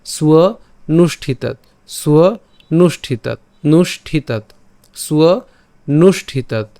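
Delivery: সুয় then a short pause, then নুষ্ঠিতাত falling; measured drill, সুয় lifted and নুষ্ঠিতাত closing on t; two-part pronunciation drill. Shown as a demonstration. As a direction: repeat সুয় and নুষ্ঠিতাত as separate chunks. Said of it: "এই যে আমরা এভাবে উচ্চারণ করব".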